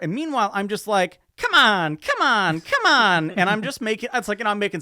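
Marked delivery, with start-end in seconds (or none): 1.4-3.3 loud, pleading chant at the machine; will the cards to hit; urging come on. Retold or imitated. Gambler begging the poker machine, loud and urgent.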